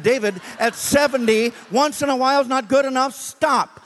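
high, loud command; urgent demand. Retold or imitated. Preacher ordering the driver to stop praying, high and loud.

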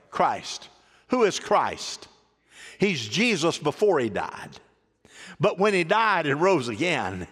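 normal talking voice

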